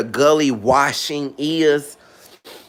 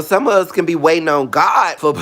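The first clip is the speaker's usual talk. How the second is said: ordinary delivery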